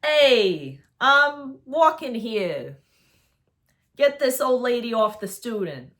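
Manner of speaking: put-on loud, high, brash New York accent, the second line slow. Bystander in New York yelling at the teacher attacking Percy, loud New York accent. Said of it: "let me try it in a New York accent".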